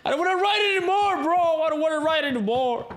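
hammed-up high, wailing complaint; mock-cry like someone utterly fed up; harto, exagerado. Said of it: "Estaba harto. Y yo". (lamento agudo y exagerado de hartazgo)

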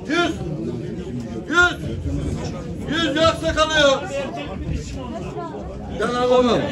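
auctioneer calling out prices. Real, not acted. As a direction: chant the bids to the crowd. Calls bids at animal auction.

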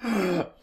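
breathy giggle trailing off; helpless laugh. Real, not acted. Co-host dissolving into laughter at the mouth noise. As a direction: let the laugh spill out.

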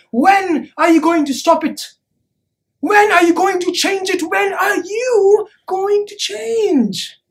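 rising pleading cry; urgent, mounting challenge to the crowd; impassioned demand. Acted.

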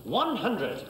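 darts score caller. Real, not acted.

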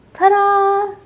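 high, loud, drawn-out flourish; sung-out, showy announcement; triumphant ta-da. A narrator's reading. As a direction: present it like a magic reveal. Daddy shows off his find.

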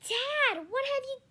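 high, loud horrified yell. A narrator's reading. Boy yells at Dad over the robot, high loud yell. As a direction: cry out at Dad in horror. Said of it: "I yelled out in honor".

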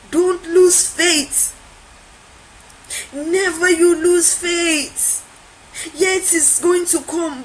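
high, slow, drawn-out urging; stretched-out, ringing appeal; emphatic exhortation. Acted. Urgent exhortation from the quote.

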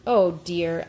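ordinary delivery, nothing marked

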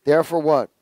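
ordinary talk, nothing marked

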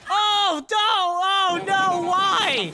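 loud high oh no, rising dismay; shocked cries. Real, not acted. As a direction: cry out in alarm at the fall. Watching ride fail, repeated cries.

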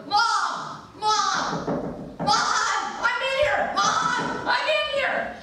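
high, frantic little-mouse calls for Mom; high squeaky panicked calling; panicked mouse cry. Acted. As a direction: cry out from inside the washer like a tiny mouse.

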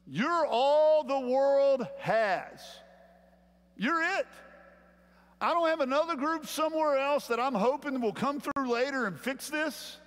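preaching at full force. Acted. Pastor urging his congregation, loud, high pleading preach. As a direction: lift it up and plead with them.

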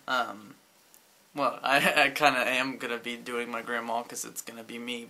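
normal talking voice

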